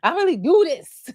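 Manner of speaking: high, loud mock of Brittany; mock Brittany outburst. Vlogger mimicking Brittany's fight outburst, hammed-up high voice. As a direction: mimic her yelling it, hammed-up.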